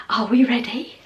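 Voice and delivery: hushed whisper; whispered build-up before the reveal. Real, not acted. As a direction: whisper it like sharing a secret. About to reveal candle.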